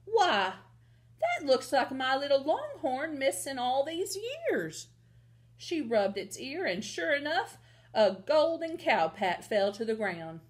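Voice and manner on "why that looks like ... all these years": high, amazed cowgirl mother; raised pitch, astonished ranch woman; astonished gasp. Acted. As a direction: exclaim it in delighted disbelief. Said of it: "Ma gasped".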